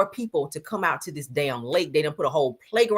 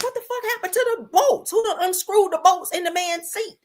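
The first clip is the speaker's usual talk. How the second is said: shocked outburst; 12 semitones higher than usual. Retold or imitated. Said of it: "I was like".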